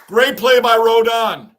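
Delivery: loud, drawn-out praise for the play; cheering shout. Real, not acted. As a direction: holler it slowly like a proud fan.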